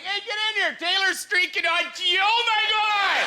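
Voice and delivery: high, loud excited calling; excited buddies yelling. Retold or imitated. Buddies calling a friend to the window, excited yell. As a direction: shout it like buddies cheering from the house.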